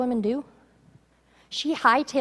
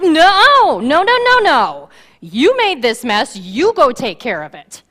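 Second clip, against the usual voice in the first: loud, high no, then slow and firm; mother shouting at the prophet to fix it; shouted refusal; 4 semitones higher, 9 dB louder, slower than usual. Acted. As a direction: refuse him flat out, loud.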